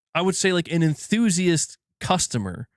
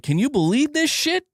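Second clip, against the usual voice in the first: outraged disbelief; 12 semitones higher than usual. Retold or imitated. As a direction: throw it up in disbelief. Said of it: "I told Missus W G".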